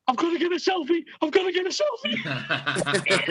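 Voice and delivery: high, slow giddy fan voice, laughing; excited fan. Retold or imitated. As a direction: squeal it like a starstruck fan. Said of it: "And he went".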